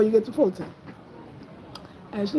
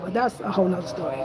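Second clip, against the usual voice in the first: normal talking voice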